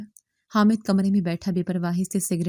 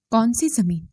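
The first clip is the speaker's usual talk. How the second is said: plain reading voice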